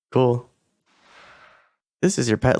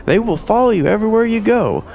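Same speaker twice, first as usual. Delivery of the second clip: cheery, higher merchant sales pitch; eager merchant hawking pets; 5 semitones higher than usual. Acted. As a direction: sell the creature like a fairground hawker.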